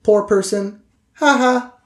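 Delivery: high-pitched, pointed character voice; Trixie, passive-aggressive. Acted. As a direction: pitch it way up with a put-upon edge. Game master voices Trixie.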